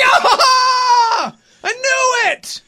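high, loud whoop of victory; hammed-up cheer at a quiz win; triumphant yell. Kids and parent nailing a Jeopardy answer, triumphant yell.

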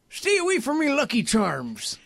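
mock Irish-style accent, played for laughs; put-on old-age accent. Joking about being old together, put-on Lucky Charms accent. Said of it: "I got an accent in my old age".

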